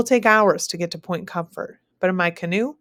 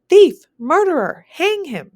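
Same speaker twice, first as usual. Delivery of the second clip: very loud, high furious yells; yelling; 11 semitones higher, 9 dB louder, slower than usual. Acted. Anne accusing the narrator before everyone, yelling. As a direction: hurl each accusation at him. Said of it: "she yells".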